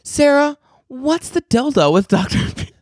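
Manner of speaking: high, confident holler across an office; mock the boss calling out, breaking off at the end; high-pitched mimicked yell; imitating boss yelling. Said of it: "I hear her yell from the office".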